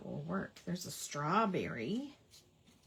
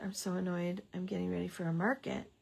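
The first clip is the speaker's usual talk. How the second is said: normal talking voice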